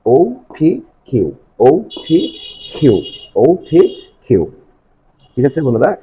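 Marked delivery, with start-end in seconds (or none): 0.1-4.5 slow, spaced "ও পি কিউ"; practice letter drill. Shown as a demonstration. Teacher practising with pupils, "ও পি কিউ" slow and separated. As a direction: lift "ও পি কিউ" letter by letter, slowly. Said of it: "practicing with me".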